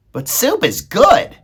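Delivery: high, loud, slow little-mouse voice; Despero objecting. A narrator's reading. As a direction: pipe up loudly, pitched high. Despero counters Meg's objection.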